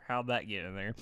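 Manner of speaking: ordinary delivery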